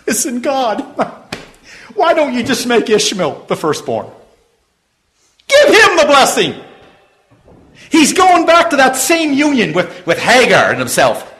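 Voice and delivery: loud, high, ringing preacher voice; full-force preaching. Acted. Preacher calling out to his congregation, full-force preaching. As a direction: preach it out to the whole room.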